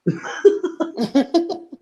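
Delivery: high-pitched giggle; high laugh. Real, not acted. Laughing at own joke.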